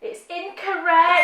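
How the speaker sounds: slow, high, loud announcement; drawn-out verdict. Real, not acted. Quiz host tells contestant her answer is wrong, drawn-out verdict. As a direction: stretch out the bad news loudly.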